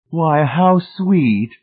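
high, loud, drawn-out gushing; delighted exclamation. A narrator's reading. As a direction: exclaim slowly in a high lady's voice. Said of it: "Missus Pritchett exclaimed".

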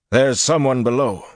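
loud, high warning; sudden alert. A narrator's reading. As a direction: call out a sudden sighting. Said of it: "Flynn said suddenly".

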